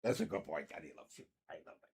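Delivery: soft, hushed cooing to a pet; tender dog talk. Real, not acted. Owner cooing softly to his dog, tender and hushed. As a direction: coo softly to your dog.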